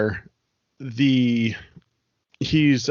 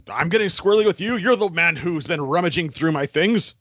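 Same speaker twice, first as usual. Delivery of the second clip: fast, higher-pitched accusing comeback; indignant retort; 3 semitones higher than usual. Acted. Ancaster accuses the man rummaging through his things, indignant. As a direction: throw the accusation straight back, agitated. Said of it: "He looks at you and goes".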